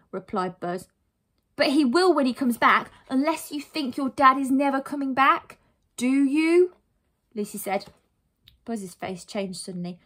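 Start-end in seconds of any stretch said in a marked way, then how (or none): 1.6-6.7 high, loud, insistent; pointed challenge. Acted. Heroine challenges boy about absent dad, pointed insistence. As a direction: press him until he must answer.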